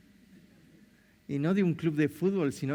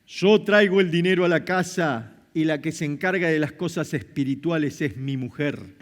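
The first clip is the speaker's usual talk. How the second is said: louder, self-satisfied man's voice; smug macho husband; 2 semitones lower, 5 dB louder than usual. Retold or imitated. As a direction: play the man who leaves faith to his wife. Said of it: "Algunos varones hasta el día de hoy piensan". (marido machista y ufano, más fuerte)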